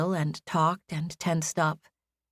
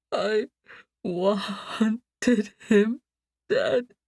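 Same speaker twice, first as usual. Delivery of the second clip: muffled sob; 4 semitones higher, much slower than usual. Acted. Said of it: "muffled gasp".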